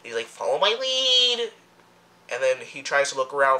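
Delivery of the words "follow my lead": high, stretched-out geek call; mock a soldier as a squeaky nerd; nerdy geeky voice. Mimicking a military soldier's line, nerdy and high. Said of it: "in the most nerdy, geeky voice ever".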